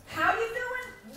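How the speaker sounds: high, slow cheerful how you doing; drawn-out friendly question; cheery greeting. Retold or imitated. Sister greeting brother on phone, high and slow. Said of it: "That's my first mistake".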